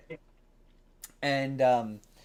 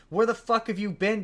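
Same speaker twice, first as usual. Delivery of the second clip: a touch of retold anger, pitched higher; mildly mock an angry demand; pissed off; 7 semitones higher than usual. A touch of Katherine Heigl's character telling him off, mildly angry. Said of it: "is pissed off and is like".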